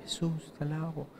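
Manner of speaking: barely audible mock praise, as if afraid to be heard; hushed, embarrassed worship; timid murmur. Shown as a demonstration. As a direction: murmur the praise so nobody hears. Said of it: "así que nadie nos escuche".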